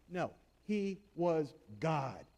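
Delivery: he was God, said slowly with each word weighed; slow emphasis on was God. Shown as a demonstration. Pastor correcting a false creed with slow weight on was God. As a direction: lean on was God with no A before God. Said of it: "Don't you let anyone put an A before that".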